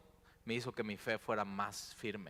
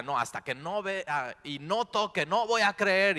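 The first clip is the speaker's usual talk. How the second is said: loud, higher, refusing outright; stubborn shout; 8 semitones higher, 8 dB louder than usual. Retold or imitated. Thomas insisting he won't believe, shouting. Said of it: "Tomás de pronto está".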